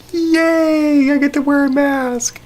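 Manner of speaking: high, squealy fake cheer; sarcastic happy squeal; mock enthusiasm. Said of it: "No one's like".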